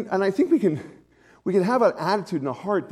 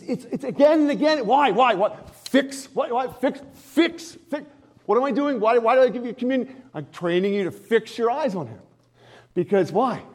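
raised, fervent preaching voice, slowed into the key line; preaching at full force; 9 semitones higher, 4 dB louder than usual. Real, not acted. Pastor to his congregation on Philippians, fervent preaching. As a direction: drive the scripture home to the room. Rapid questioning about communion.

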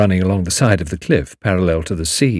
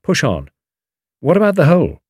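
impatient; 7 semitones higher than usual. A narrator's reading. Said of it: "moved his feet impatiently".

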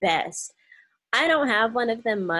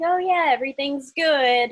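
fake cheerful; 7 semitones higher, 8 dB louder than usual. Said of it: "lying right through my teeth".

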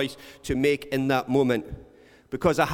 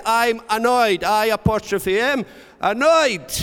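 louder and higher, spelling each piece clearly; 6 semitones higher, 6 dB louder than usual. Retold or imitated. Spelling the title loudly for the man on the phone. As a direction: call the title out so he gets it.

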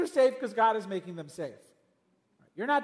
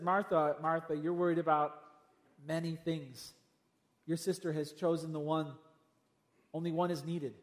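soft, lower and calm; gentle; 5 semitones lower, 5 dB quieter than usual. Retold or imitated. Jesus gently correcting Martha, soft and tender. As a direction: speak it kindly, like gently correcting a friend. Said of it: "Jesus was so gentle".

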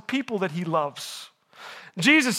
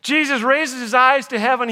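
ordinary talk, nothing marked

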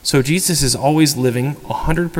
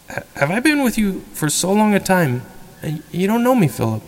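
higher, puzzled question as Jesus; perplexed; 7 semitones higher than usual. Retold or imitated. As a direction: ask it gently bewildered, as if hurt he's unknown. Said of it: "he sounds perplexed".

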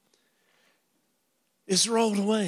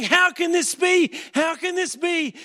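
panicked Satan; 8 semitones higher than usual. Acted. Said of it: "And he's going".

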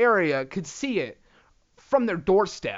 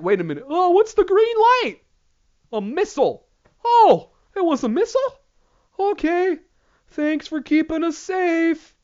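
high, airy voice of a clueless bystander; mock clueless onlooker; 11 semitones higher, 6 dB louder than usual. Mocking gullible people watching the missile, high airy voice. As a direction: play it wide-eyed and naive, pitched up. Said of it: "people who are like".

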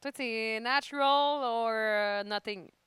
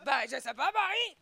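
lightly acted commercial reply, name pitched up; TV ad acting; 3 semitones higher than usual. Co-host replaying the ad's reply to tease, lightly hammed-up. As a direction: answer like the other character in an ad. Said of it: "je m'en souviens, par contre, du texte".

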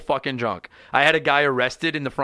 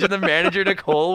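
usual speaking voice

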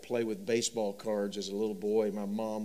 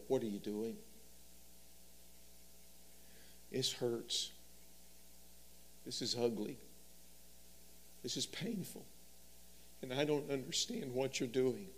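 softer pained plea to God; quiet lament; 2 semitones higher, 8 dB quieter than usual. Retold or imitated. Believer asking the Lord why it hurts, softly pained. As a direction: let the hurt show quietly.

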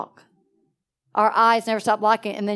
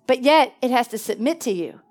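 ordinary talk, nothing marked

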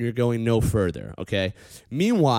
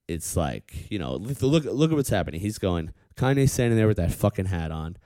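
ordinary talk, nothing marked

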